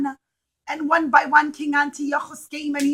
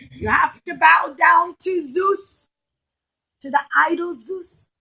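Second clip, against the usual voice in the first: soldiers' demand; 4 semitones higher, 7 dB louder than usual. Acted. Soldiers demand idol worship.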